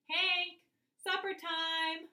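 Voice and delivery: loud, high, drawn-out call. A narrator's reading. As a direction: call the dog across the yard. Calling the dog outside.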